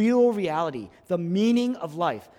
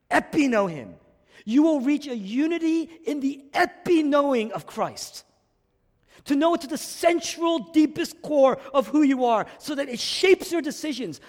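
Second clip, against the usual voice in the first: higher and louder, building intensity; impassioned preaching; 9 semitones higher, 6 dB louder than usual. Acted. Preacher pressing the congregation to epi-know him, fervently. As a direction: drive it home with fervor.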